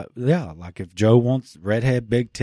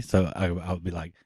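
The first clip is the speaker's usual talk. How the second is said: ordinary talk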